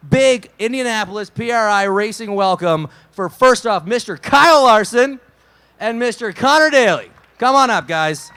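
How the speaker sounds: loud, booming guest welcome; announcer intro. Real, not acted. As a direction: hype up the crowd. Introducing guests to live crowd.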